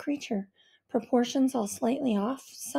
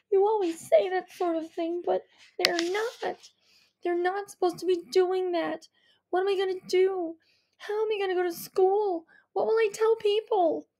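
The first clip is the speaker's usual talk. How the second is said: put-on higher, anxious protesting girl; upset daughter; 7 semitones higher than usual. A narrator's reading. Daughter protesting to her mother, anxious and upset. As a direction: spill out the panic about school. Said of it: "I swipe them away angrily".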